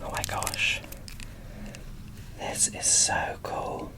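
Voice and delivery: ordinary delivery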